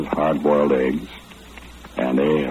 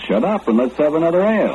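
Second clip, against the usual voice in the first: jovial; 13 semitones higher than usual. Retold or imitated. Jovial refusal of thanks.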